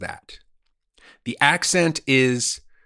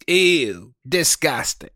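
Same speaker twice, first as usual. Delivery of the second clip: imitating a grossed-out kid, higher and louder; mock disgust; 4 semitones higher, 4 dB louder, slower than usual. Five-year-old daughter reacting to a parent's fart, imitated disgust. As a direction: recoil with an exaggerated childish ew.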